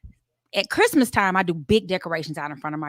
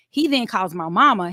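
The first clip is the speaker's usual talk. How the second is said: ordinary talk, nothing marked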